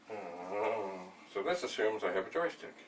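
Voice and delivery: ordinary delivery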